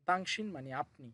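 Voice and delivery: ordinary talk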